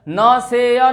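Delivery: न से या drawn out as "naa-say-yaa"; wrong-example long "naa-say-yaa" for न से या; majhool vowel. Shown as a demonstration. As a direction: stretch न से या with a long "ay". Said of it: "नहीं बोलना है आपको".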